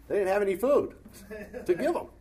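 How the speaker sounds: preacher marvelling that the disciples had no food; incredulous. Real, not acted. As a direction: stress how absurd it was. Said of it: "incredulous emphasis".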